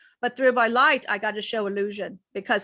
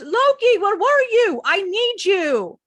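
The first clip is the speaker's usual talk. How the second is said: high, loud desperate plea, slowing at the end; pleading cry; 10 semitones higher, 6 dB louder, slower than usual. Acted. Lilith calling to Loki, high desperate plea. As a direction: call out to him, needing him.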